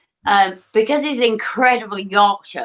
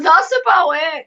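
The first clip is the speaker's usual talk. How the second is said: higher-pitched broad Yorkshire; Yorkshire accent; 7 semitones higher than usual. Shown as a demonstration. Host showing the one accent she can do, broad Yorkshire. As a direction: put on a big Yorkshire voice. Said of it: "I can kind of big Yorkshire".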